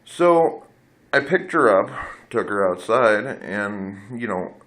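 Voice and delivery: ordinary delivery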